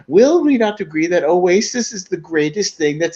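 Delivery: rising mock parliamentary plea; mock MP question. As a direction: ham up an eager backbencher. Retelling Will Ferrell's MP questions.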